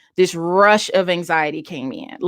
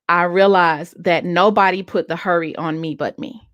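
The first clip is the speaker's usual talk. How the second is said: normal talking voice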